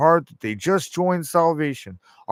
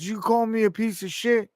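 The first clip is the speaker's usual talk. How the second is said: high, rising indignant question; retold driver taking offense; offended driver; 9 semitones higher than usual. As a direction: voice the insulted driver. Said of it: "He said".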